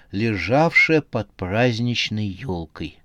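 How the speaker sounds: plain reading voice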